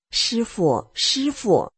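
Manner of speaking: rising, earnest plea to the master; pleading call. Retold or imitated. Woman unable to read the book, pleading with the master. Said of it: "我就求师父".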